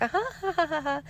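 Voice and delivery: imitating a toddler's very high giggle; high mock giggle, carefree; little girl giggling. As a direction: giggle like a bubbly small child. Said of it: "she's back to herself again".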